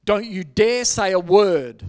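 stern warning, loud and slow; Holy Spirit. Retold or imitated. Commanding the pastor to stay silent, stern loud warning. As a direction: warn slowly and forcefully, word by word. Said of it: "And the Holy Spirit's going".

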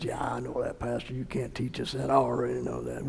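low, quiet know-it-all mumble; mock dismissive congregant. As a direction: imitate a bored listener brushing off the pastor. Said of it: "Everybody says".

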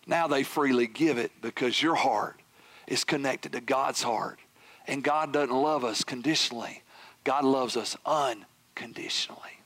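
low, gentle sermon delivery with pauses; hushed preaching. Real, not acted. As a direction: bring it down low and let it sink in. Drops into soft preaching.

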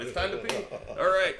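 body's announcement. Retold or imitated. His body telling him at the spot, playful high announcement. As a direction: voice your bladder as a cheery alarm.

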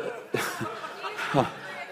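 breathy embarrassed laugh through tears; teary laugh. Real, not acted. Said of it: "I'm getting emotional".

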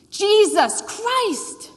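loud, high, drawn-out exasperated cry; exclaimed oath. Retold or imitated. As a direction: blurt the name as a shouted expletive. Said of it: "the father evokes the name".

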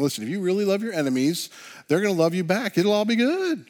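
hammed-up rosy optimism; mock naive optimism. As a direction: parody an easy reassurance, pitch climbing to the end. Mimics naive take on teaching.

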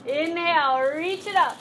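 ordinary talk, nothing marked

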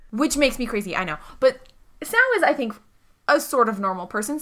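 ordinary talk

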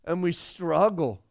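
usual speaking voice

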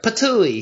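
high comic patooey; mock spit sound. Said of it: "they were like".

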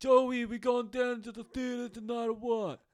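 high, slow mock Boston accent. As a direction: ham up a bad Boston accent, stretched out. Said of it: "work on my Boston accent".

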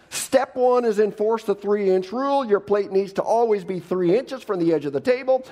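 hammed-up nitpicker reciting his rules; fussy know-it-all. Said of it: "Others of you might say".

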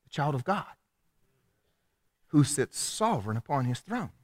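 soft, unhurried wonder with long pauses; calm trust. Acted. Believer answers trouble with trust in God, calm and soft. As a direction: ponder it quietly, phrase by phrase. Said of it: "the first thing you did was go".

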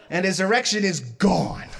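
usual speaking voice

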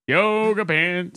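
slow, drawn-out high slogan. Pretend. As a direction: stretch it like a jingle. Mock sponsor slogan.

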